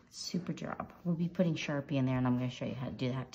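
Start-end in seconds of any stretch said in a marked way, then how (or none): none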